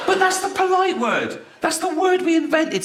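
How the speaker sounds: high-pitched, exasperated objection; exasperated protest. Acted. Exasperated protest in his routine.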